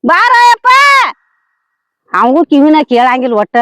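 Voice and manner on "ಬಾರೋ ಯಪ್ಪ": calling out. Acted. Mother calls her son to come, hollering. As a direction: holler him over from a distance. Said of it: "ಯಾಕ್ ಒದರಾಗ್ತದಿ?".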